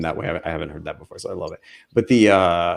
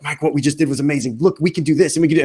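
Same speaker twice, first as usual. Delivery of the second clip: high, fast and eager, rushing ahead; imitating his own hyped self; excited; 7 semitones higher, faster than usual. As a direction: gush about the big win to Mike. Excitedly pitching ideas to Mike.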